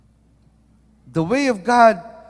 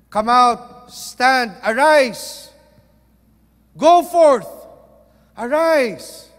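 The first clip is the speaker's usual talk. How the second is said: booming preacher's command, louder and rising; 8 dB louder, slower than usual. Shown as a demonstration. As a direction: thunder the call out of the cave. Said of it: "Malakas ang boses, mataas ang boses".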